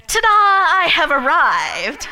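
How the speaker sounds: loud, high, drawn-out proclamation; theatrical ta-da fanfare; grand self-announcement. Acted. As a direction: announce your arrival to the world, slowly and loudly. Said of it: "and I go".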